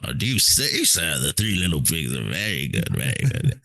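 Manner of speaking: Shakespearean English accent played for laughs; mock Shakespeare accent. Podcast host jokingly praising Three Little Pigs, mock Shakespearean English accent. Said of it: "Shakespeare accent".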